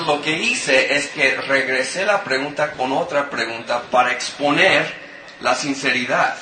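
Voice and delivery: ordinary talk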